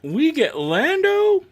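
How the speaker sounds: high, drawn-out delighted question; excited surprise. Real, not acted. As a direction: blurt it out loud and long, thrilled. Surprised discovery of favourite character.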